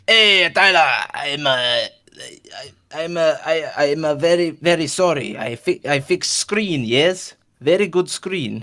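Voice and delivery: loud hail, then stumbling broken English in a put-on accent; weird foreign accent. Shopkeeper reports back on the screen, halting foreign accent. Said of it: "he's gonna do weird voices".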